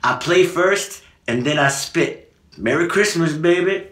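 [name] quote. Retold or imitated. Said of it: "we combined the two".

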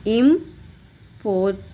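"इम्पोर्टेंट" with long gaps, im... por... tent; separated syllables. Shown as a demonstration. Teacher demonstrating how it sounds, "इम्पोर्टेंट" broken into three syllables. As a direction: pause after each syllable of "इम्पोर्टेंट". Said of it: "ऐसे sound करता है".

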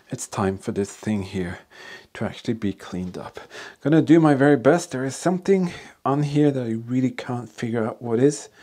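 normal talking voice

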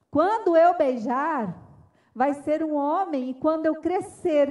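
loud, slow, emphatic refusal; defiant declaration. Retold or imitated. Nine-year-old refusing to kiss, acted loud defiance. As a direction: declare it firmly, stretching the key words.

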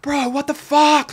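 very high, drawn-out mock scream; retold screaming. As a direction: replay a scared scream slowly, not full volume. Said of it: "just screaming".

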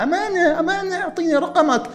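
very high squealing fangirl voice; girly squeal. Pretend. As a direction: squeal it like a smitten girl. Girls begging Sebastian for number. (صوت بنت متحمسة عالي جداً)

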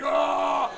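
loud sustained power-up cry; cartoon yell. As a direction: imitate an anime hero powering up. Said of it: "All they do is yell".

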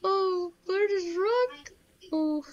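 high, strained wail that cracks; voice cracking. Real, not acted. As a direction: let the voice crack up high, drawn out. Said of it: "my voice is cracked".

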